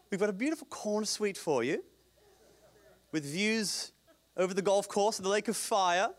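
high, jokey pseudo-English devil voice; hammed-up welcome in a dubious English accent; weird accent. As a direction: play an English-accented host fawning over a guest. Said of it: "He just has a weird accent".

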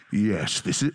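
loud, very deep mock-divine voice; Jesus. Preacher showing how he hears the Lord, booming low. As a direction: boom it out low like a pretend God. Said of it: "That's how I hear his voice".

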